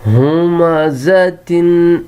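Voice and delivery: ہمزتن drawn out, hu-ma-za-tin; slow model reading. Shown as a demonstration. Teacher to pupils, drawn-out model word. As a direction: sound out ہمزتن slowly. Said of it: "اگلا".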